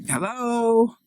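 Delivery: a high, loud mother's hello down the phone; high mom hello. Acted. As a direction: answer the speakerphone as a high-voiced mom. Mom answers speakerphone.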